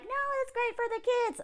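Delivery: high, soft fake-cheerful voice; a sugary pretend-fine wife; mock sweet ex-wife. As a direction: sing it out like a forced-happy ex-wife. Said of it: "ex-wife Sandy was all like".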